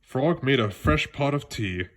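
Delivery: usual speaking voice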